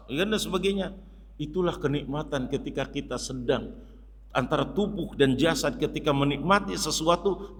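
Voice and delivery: ordinary delivery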